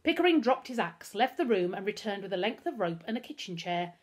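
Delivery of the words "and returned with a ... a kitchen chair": usual speaking voice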